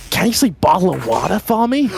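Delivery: mock American accent. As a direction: put on a high American voice asking a favour. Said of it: "the American".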